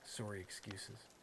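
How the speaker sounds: low, soft, slow Canadian "sorry"; understated Canadian accent on "sorry". Shown as a demonstration. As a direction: repeat it quietly with a Canadian vowel. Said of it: "I did a Canadian sorry there".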